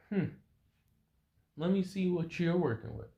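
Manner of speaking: high, soft, teasing woman's voice; flirtatious female fighter. Acted. Woman fighter leans toward downed man for kiss, teasing. Seductress toys with fallen fighter.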